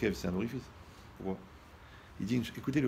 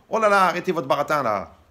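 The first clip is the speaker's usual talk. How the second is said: put-on higher, much louder scolding old lady; old wife voice; 7 semitones higher, 12 dB louder than usual. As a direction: snap at the rabbi like an impatient grandmother. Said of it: "vous devez susciter le besoin".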